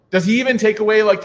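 ordinary talk

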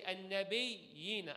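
An-nabiyina slow, steady doubled n, no vibration; careful recitation. Retold or imitated. Sheikh making his student repeat the word, slow careful An-nabiyina. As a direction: spell out An-nabiyina slowly like the Shaykh demands. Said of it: "until you say".

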